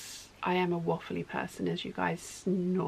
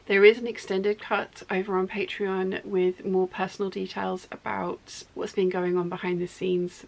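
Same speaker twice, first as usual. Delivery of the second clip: normal talking voice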